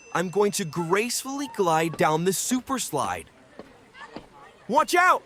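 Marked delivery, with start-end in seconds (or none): 4.7-5.2 high, loud warning cry; calling out. Acted. Bean warning the pool below, calling out loudly. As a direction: clear the way, shout it up high.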